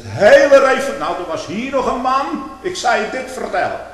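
heated preaching. Acted. Impassioned preaching outburst.

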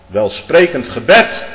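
loud, high and very slow "welsprekend gebed"; slow emphatic preaching. Acted. Preacher mocking eloquent prayers, slow and loud. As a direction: drag the words out for weight.

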